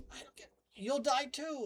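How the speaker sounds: lightly voiced betrayed frog; shocked frog. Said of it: "Frog's like".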